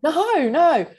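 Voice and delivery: high, loud retold protest; emphatic denial. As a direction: act out an astonished, insistent no. Boss denying it, retold.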